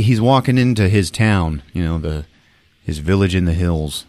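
ordinary delivery

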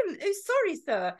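high posh English, dropping on "sir"; a meek, plummy posh apology; putting on posh. Retold or imitated. Officer apologising to the posh tramp, putting on posh. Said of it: "I started talking posh myself".

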